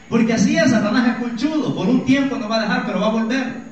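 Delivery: loud, high preacher's cry; fiery preaching. Real, not acted. Pastor warns the congregation about Satan, fiery preaching. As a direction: hammer it home to the congregation.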